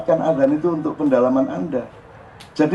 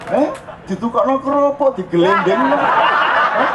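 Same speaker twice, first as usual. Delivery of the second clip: mock teasing; 9 semitones higher, slower than usual. Shown as a demonstration. Mock scolding of the money.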